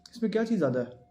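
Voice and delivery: usual speaking voice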